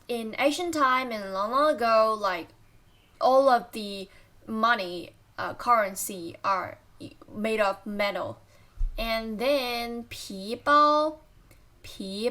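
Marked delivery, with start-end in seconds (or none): none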